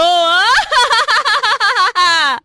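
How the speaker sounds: loud high evil cackle; piercingly high, full-force villainess laugh; witch cackle. Acted. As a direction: throw out a long, soaring witch laugh.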